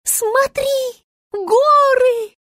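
high, loud star's squeal; excited squeal. A narrator's reading. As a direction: squeal it high into the hare's ear. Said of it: "визжала она зайцу в ухо". (восторженный визг)

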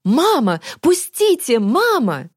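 high, anguished calling; straining, desperate cry. A narrator's reading. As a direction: cry out for mother in despair. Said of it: "крикнул надсадно, отчаянно". (надсадный, отчаянный крик)

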